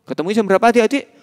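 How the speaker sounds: plain reading voice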